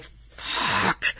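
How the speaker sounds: a breathless, voiceless fuck; whispered curse. Retold or imitated. Reacting to a crying villain, a whispered curse. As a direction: barely let it out, under your breath. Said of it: "and I'm like".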